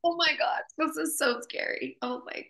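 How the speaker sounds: high, small scared voice; light mock-frightened whimper; mock cowering. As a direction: play at cowering in fear, half-joking. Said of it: "cowering in the corner, crying".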